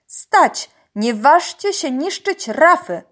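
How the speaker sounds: high, loud command; shouting from afar. A narrator's reading. Uncle shouts at poachers from the boat, commanding. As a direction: order them to stop across a distance. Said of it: "wołał z daleka wuj".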